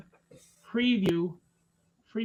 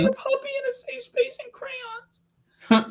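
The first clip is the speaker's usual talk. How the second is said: high, slow, whimpering mock crying; mock a sobbing snowflake; whiny crybaby voice; 8 dB louder, slower than usual. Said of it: "We're like".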